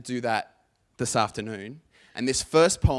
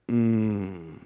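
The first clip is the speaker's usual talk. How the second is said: guttural grunt; 5 dB louder than usual. Shown as a demonstration. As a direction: demo an approving grunt. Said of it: "one of these guttural grunts".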